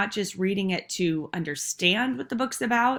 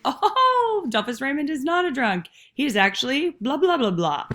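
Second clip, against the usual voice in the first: mock-surprised gasp of discovery, laughing, starting much higher and louder; surprised realization; 6 semitones higher, 4 dB louder than usual. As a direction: act out the reader's sudden 'oh!' moment, half laughing. Students' sudden realization, acted out.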